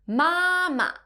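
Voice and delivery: "Mama," held high and level; "Mama," like a sustained high note; first flat tone. Shown as a demonstration. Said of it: "which has the first flat tone".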